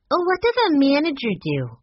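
put-on high, curious child voice; young girl. A narrator's reading. Young Sue Lee asks curiously.

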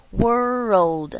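put-on "world" slow and loud, gliding wor into old; "world" stretched with a "row" sound in the middle; linked syllables. Shown as a demonstration. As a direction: link the r into the o of "world". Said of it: "做一个连音".

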